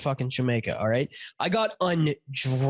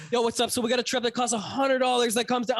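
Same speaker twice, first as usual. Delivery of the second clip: high-pitched hustler's spiel; imitating pushy pitch; 7 semitones higher than usual. Driver to passengers on the shuttle, hammed-up pitch. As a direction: launch straight into a mock sales pitch. Retold taxi guy's instant sales pitch.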